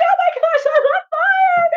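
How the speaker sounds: very high panicked cry; panicked raven. Acted. The raven, feathers on fire, cries out in high panic. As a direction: shriek as the feathers burn. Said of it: "he was like".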